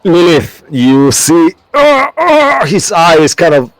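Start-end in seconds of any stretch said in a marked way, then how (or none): 1.8-2.7 high, loud acted groans of strain. GM acts out the Cyclops straining not to blink, high strained groans. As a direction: groan as if losing the struggle.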